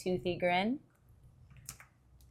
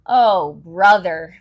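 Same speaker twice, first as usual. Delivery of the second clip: louder, slow, fed-up moan; 2 semitones lower, 11 dB louder, slower than usual. Acted. Lucy finds Linus writing again, a fed-up moan. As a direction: drag it out, sick of her brother. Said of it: "moaned Lucy".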